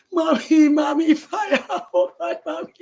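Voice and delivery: put-on high, loud frightened child crying out; panicked cry. Burning child calls to her mother, panicked crying shout. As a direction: scream for mommy in panic. Child screams about fire.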